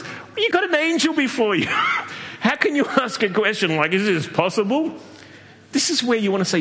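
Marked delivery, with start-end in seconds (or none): 0.0-4.9 incredulous. Real, not acted. Preacher scoffing at Zechariah's doubt, incredulous.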